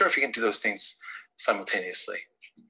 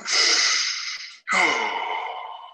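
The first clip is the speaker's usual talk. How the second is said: sigh out. Shown as a demonstration. Coach leading a warm-up, long releasing sigh. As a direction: let out a big releasing sigh. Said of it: "and sigh out".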